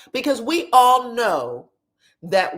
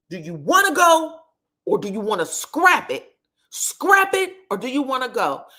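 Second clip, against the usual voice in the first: mock yell; 4 semitones higher, 3 dB louder than usual. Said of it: "He kept yelling at her like that".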